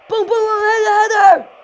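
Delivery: very loud, high excited yell; screaming. Real, not acted. Gamer calling a header at the camera, screaming. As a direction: scream each word as the header comes. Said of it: "I'm screaming a lot at the camera".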